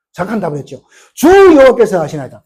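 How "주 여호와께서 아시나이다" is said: louder, higher proclamation; proclaimed answer. Acted. Ezekiel's answer repeated for the congregation, in a loud proclaiming voice.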